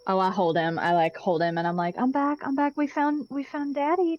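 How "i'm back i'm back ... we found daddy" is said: tender reunion. Acted. Parent holding the sobbing child after returning.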